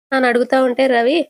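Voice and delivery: ordinary delivery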